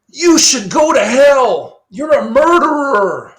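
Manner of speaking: high, loud, slow condemnation; accusing voice. Retold or imitated. Lecturer voicing a student condemning the murderer, mock outrage. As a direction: cast judgment on the murderer like a preacher. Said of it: "And you say".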